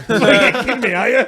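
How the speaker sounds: loud, high mock outrage; incredulous protest. Radio stunt recruit balking at being sent to Woodridge, incredulous. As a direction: protest loudly in disbelief through the laughs.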